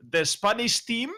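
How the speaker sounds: put-on light Spanish accent, pitch up. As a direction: say it with a hint of Spanish. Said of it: "Did you like my Spanish accent there".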